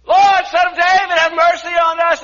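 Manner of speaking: loud high pleading cry. Retold or imitated. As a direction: yell it out, desperate. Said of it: "They yelled the more".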